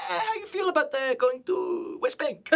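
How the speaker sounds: high, drawn-out, tentative producer voice; imitating his producer. Producer to the host about going to West Bank, in a high, slow mock voice. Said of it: "And he was like".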